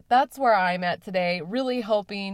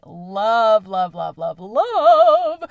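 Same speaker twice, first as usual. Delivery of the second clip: gushing excitement; 10 dB louder, much slower than usual. Real, not acted. Host gushing over her favorite time of year, excited. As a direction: spill over with delight on each love.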